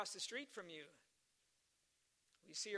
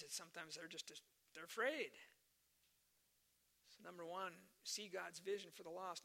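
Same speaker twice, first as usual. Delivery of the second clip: ordinary delivery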